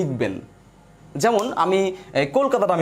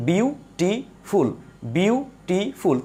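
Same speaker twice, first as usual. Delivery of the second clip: "Beautiful" slowly, a gap after বিউ and after টি; syllable by syllable; 3 semitones higher, much slower than usual. Shown as a demonstration. Teacher counting steps for the class, syllable by syllable. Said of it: "কয়টা স্টেপে এটা উচ্চারণ করতে পারছি".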